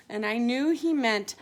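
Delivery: plain reading voice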